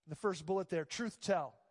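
usual speaking voice

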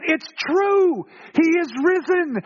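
high, excited announcement; amazed proclamation. Acted. Disciples' eyes are opened, amazed and exultant. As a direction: proclaim it with pitch soaring.

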